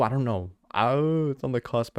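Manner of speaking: ordinary talk, nothing marked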